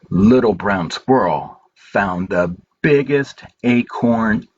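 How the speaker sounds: gentle, unhurried picture-book reading with long pauses; reading to children. Acted. Reading A Good Day to children at story time, gentle and slow. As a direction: read it slowly to little ones, letting each page land.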